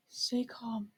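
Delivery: hushed self-reassurance; a nervous whispered reminder; whispered to himself. Acted. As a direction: whisper it to calm yourself. Man talks himself down, nervous.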